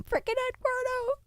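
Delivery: Eduardo sung out very high; showy name drop. Pretend. Streamer proudly naming the bot Eduardo, very high.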